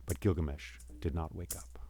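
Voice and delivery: low, soft, ominous; grave storyteller hush; hushed narration. A narrator's reading. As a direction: let it sink, softly. Narrator drops after shouts.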